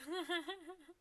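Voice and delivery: high, loud giggling burst; laughing. Real, not acted. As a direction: break up laughing at yourself. Laughing at own pronunciation.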